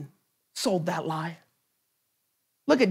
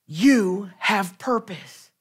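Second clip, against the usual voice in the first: slow example declaration; 3 semitones lower, much slower than usual. Shown as a demonstration. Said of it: "turn back to him and tell him".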